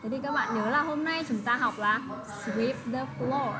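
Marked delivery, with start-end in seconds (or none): 2.4-3.5 slow model reading. Shown as a demonstration. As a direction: read it slowly for pupils to copy. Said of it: "model reading for class".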